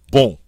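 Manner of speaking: "Bon" as one crisp nasal burst; French "Bon", N unsounded, cut short. Shown as a demonstration. Teacher models French nasal word, short and crisp. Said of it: "Short and crisp".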